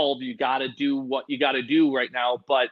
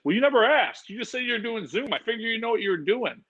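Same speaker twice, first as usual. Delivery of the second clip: lightly voiced smug tech buddy; 7 semitones higher than usual. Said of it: "he's like".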